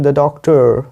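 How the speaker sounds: "doctor" with a curled American r. Shown as a demonstration. Teacher contrasting accents, American rhotic "doctor". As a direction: say "doctor" the American way, tongue bent back. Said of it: "American में आप बोल सकते हैं".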